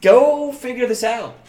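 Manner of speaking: a high, drawn-out plea to Jaime; acting out Brienne. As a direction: play Brienne urging Jaime to think. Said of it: "and says".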